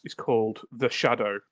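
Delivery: ordinary talk, nothing marked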